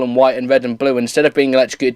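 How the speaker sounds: ordinary talk, nothing marked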